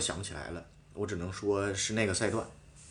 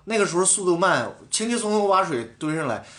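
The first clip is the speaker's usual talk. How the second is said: higher, louder heated retort; retold argument; 6 semitones higher, 3 dB louder than usual. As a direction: argue back with mild heat. (复述在车上与队友争执，语气激动)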